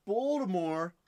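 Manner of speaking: clumsy put-on Baltimore accent, "Bawldamore". Shown as a demonstration. Card seller trying the local pronunciation for viewers, hammed-up Baltimore accent. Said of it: "I can't do a good Baltimore accent".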